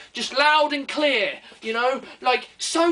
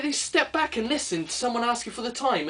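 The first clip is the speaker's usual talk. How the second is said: ordinary talk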